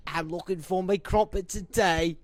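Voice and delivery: high, loud hammed-up English accent. Prank caller phones England, exaggerated English accent. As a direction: put on a silly English caller's voice. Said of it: "you do an English accent".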